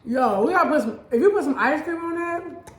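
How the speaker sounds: can't contain the idea; thrilled suggestion; excited. Real, not acted. Excited about taste.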